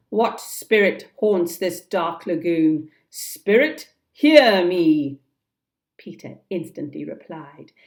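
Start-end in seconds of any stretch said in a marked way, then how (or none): 0.1-5.2 loud challenge to the dark; raised, calling voice. Acted. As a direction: call out across the lagoon. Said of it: "Hook raised his voice and cried".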